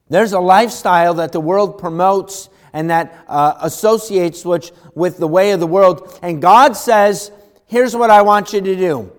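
loud, driving preacher's voice; fiery preaching. Acted. Preacher warning the congregation, loud and fervent. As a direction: preach it hard, pressing each warning.

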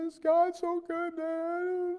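very high, drawn-out imitated wail; bawling daughter. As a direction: mimic a child bawling, slow and squealing. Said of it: "She is bawling".

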